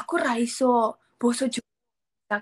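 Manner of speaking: put-on light Javanese accent from a foreigner; slow Javanese words, lightly accented; mock foreigner in Javanese; foreigner speaking Javanese. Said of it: "bule bisa bahasa Jawa".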